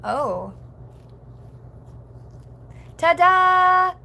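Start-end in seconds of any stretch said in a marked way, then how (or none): 3.0-3.9 high, loud, held-out ta-da; big showy flourish; playful ta-da. Pretend. Streamer capping the impression, loud playful ta-da.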